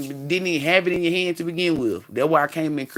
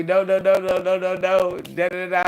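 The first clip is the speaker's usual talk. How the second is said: run-on nagging on one raised note; mock objecting; 3 semitones higher than usual. Speaker imitates people trying to tell him otherwise, mock objecting. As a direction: mimic naysayers in a rapid string.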